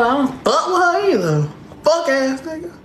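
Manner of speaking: ordinary talk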